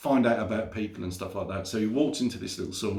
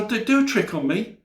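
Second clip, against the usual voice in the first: high, keen pleading; eager begging; 10 semitones higher, 4 dB louder than usual. Acted. Rob begging the magician for a trick, high and eager. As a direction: beg like an excited kid.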